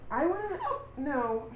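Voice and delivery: hiccup breaking the words. Real, not acted. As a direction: talk through a hiccup. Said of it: "I have the hiccups".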